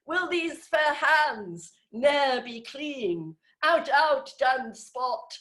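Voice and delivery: drawn-out Shakespearean melodrama, pitched high; Lady Macbeth. Acted. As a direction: declaim it like a stage tragedienne. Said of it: "Lady Macbeth going".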